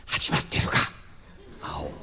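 whispered question, no voice at all. Acted. Told to come in quietly, friend asks in a whisper. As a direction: breathe the words so nobody outside hears. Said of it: "静かに入ってこい".

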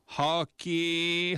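slow, drawn-out street call; a hawker's long drawn-out cry; calling out loudly. Acted. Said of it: "はっきーって大きな声で騒がんだずんば".

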